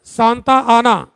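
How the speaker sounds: Santa आना said slowly and evenly; slow dictation. Shown as a demonstration. Teacher dictating Santa आना slowly to the class. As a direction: drag Santa आना out for note-taking. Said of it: "लिखो, बहुत important है".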